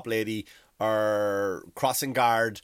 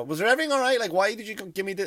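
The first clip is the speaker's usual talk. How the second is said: mock waiter; 8 semitones higher, faster than usual. Waiter chasing customer to car.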